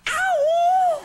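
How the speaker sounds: high whoop, shouted; whooping yell. Real, not acted. Host whoops back at audience.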